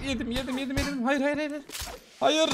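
high, rushed panicked repeats; panicked pleading. Real, not acted. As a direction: plead as the hits keep coming. Getting hit, panicking.

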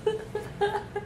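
breaking up with laughter mid-line; laughing through it. Real, not acted. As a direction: try to stay serious but crack up.

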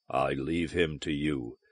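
low, quiet, dismissive; low and soft with dry contempt; sardonic. A narrator's reading. Morzak hands Donovan over to Valduma, sardonically. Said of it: "said Morzak sardonically".

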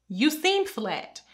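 ordinary delivery

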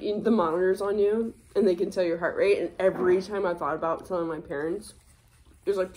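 normal talking voice

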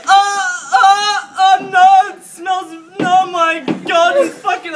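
high, loud, drawn-out cries of disgust; hammed-up horrified screaming; re-enacted screaming. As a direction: wail it out in horror, high and loud. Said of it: "So then I'm screaming".

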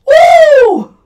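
high, loud Flair-style woo; wrestling woo. Real, not acted. Reacting to a brutal chair shot, loud high woo. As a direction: let out a shrill celebratory woo.

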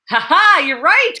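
loud, high astonished laugh-shout; astonished exclamation. A narrator's reading. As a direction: burst out amazed. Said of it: "Coke exclaimed in astonishment".

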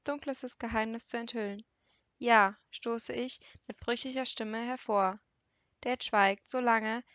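usual speaking voice

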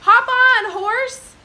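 high, loud invitation; jolly cowboy shout; calling out. Acted. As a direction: holler to the horse. Cowboy calls to the horse.

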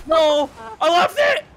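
loud, high yell of dismay; real dismayed shout. Real, not acted. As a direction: yell out as the play goes wrong. Missed ball in live match.

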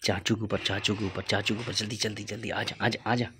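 low, very soft conspiratorial voice; hushed urging. Real, not acted. Plotting a prank on uncle, hushed urging. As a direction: urge them along secretly, fading into a whisper.

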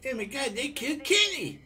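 high-pitched mock-outraged cartoon cry; hammed-up high cartoon outcry; cartoon catchphrase. Gamer parodies a cartoon line over a killed friend, high mock outrage.

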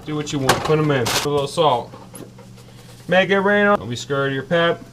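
normal talking voice

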